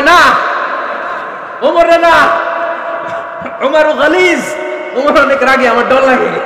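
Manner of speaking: high, loud acted protest; hammed-up fearful outcry; alarmed refusal. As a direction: refuse in a panic, nearly shouting. Acting Aisha's frightened refusal of Omar.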